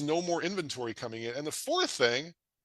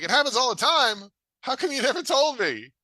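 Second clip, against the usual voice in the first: much higher and louder, astonished echo; incredulous surprise; 10 semitones higher, 4 dB louder than usual. Retold or imitated. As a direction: throw his words back in disbelief. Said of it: "I'm like".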